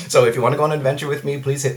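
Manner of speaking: fast and laughing. Real, not acted. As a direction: laugh through the invite. Laughs while asking.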